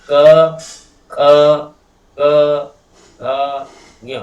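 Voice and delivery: teacher demonstrates the क row from the throat; throat consonants. Shown as a demonstration. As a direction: sound each letter from the throat. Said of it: "ये उच्चारण आप देखेंगे कंठ से".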